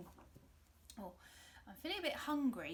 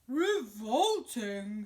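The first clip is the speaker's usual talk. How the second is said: loud, very slow bear; loud slow bear disgust; 13 dB louder, much slower than usual. Acted. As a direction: drag it out in disgust. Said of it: "said the bear".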